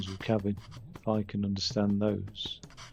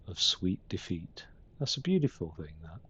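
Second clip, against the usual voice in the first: hushed and slowed, barely voiced; tender whispered aside over the child; soft whisper; 5 semitones lower, 6 dB quieter than usual. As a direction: whisper it so the sleeping baby stays asleep. Parents marvel quietly over their child.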